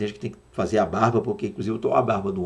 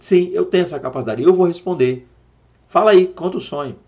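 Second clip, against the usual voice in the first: higher, louder, eager "yes I can"; a higher, louder, show-off Joseph; boastful Joseph; 6 semitones higher, 6 dB louder than usual. Pretend. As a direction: play a Joseph who brags that he can interpret. Hypothetical proud Joseph.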